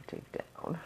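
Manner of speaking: very soft whisper; barely audible aside; muttering to self. Real, not acted. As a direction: whisper it absently to yourself. To herself while digging for charms.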